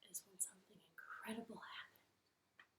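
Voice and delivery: hushed suspenseful whisper. A narrator's reading. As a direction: whisper as if telling a secret.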